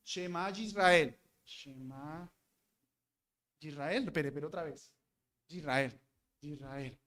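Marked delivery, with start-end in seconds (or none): 1.5-4.0 much softer, low murmured copying; lightly acted scribe murmuring under his breath; mock scribe muttering. 4.0-7.0 much softer murmured self-correction; lightly acted scribe stopping to check a word; mock scribe checking. Scribe checking his copy.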